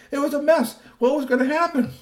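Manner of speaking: very high, excited cliffhanger; suspense. A narrator's reading. Narrator to kids, excited suspense. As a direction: keep the kids hanging.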